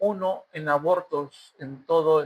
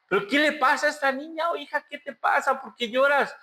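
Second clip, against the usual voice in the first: higher, sing-song coddling of "¿qué le pasa a esta niña?"; sing-song fussing parent; 6 semitones higher than usual. Retold or imitated. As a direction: croon at a crying child, lightly mocking. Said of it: "Y empezamos a cantar". (voz cantadita de papá consentidor)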